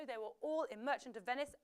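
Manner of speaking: usual speaking voice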